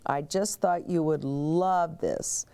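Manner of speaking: ordinary delivery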